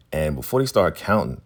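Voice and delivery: usual speaking voice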